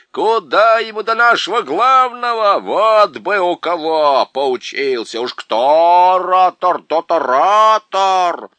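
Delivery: loud, slow boasting chorus; bawling creatures. A narrator's reading. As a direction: roar it out proudly. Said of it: "они заорали". (горделивый ор хором, громко и медленно)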